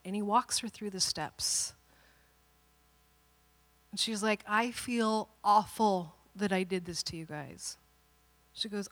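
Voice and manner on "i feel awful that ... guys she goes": put-on remorseful child. Daughter apologises.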